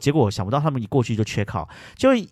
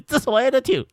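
teasing. Retold or imitated. Teasing the angry auntie, high playful mock. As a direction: poke fun at her. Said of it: "我就一直很想要闹她这样".

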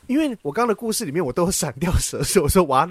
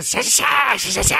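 drawn-out hissing snake noise; slow imitated snake hiss; 4 semitones higher, slower than usual. Telling a friend how the snake struck, slow hiss. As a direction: hiss it slowly like a striking snake.

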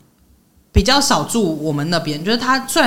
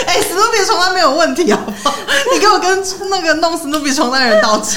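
laughing, higher, mock-indignant defence of Snoopy sheets; laughing mock protest; 9 semitones higher than usual. Real, not acted. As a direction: protest playfully through laughter. (笑着假装抗议)

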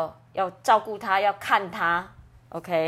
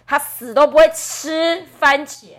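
loud, raised "死都不会吃", then "番茄"; emphatic refusal; 7 semitones higher, 10 dB louder than usual. Shown as a demonstration. Translator explains the emphasis, "死都不会吃" punched loud. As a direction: punch "死都不会吃" and keep "番茄" high. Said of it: "这里就是在强调说".

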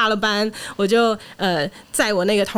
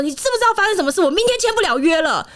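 higher-pitched, indignant retold outburst; angry phone call; 9 semitones higher than usual. Wife calling her husband about the cancelled signing, upset and higher-pitched. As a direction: fire it at him in disbelief. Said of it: "我非常生气".

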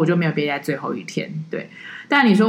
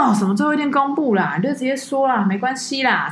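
higher, insistent mock of a pushy uncle; pushy tourist imitation; 7 semitones higher than usual. Tourist pressing the guide to reveal her age, pushy and higher. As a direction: press her like a nosy uncle who won't take no.

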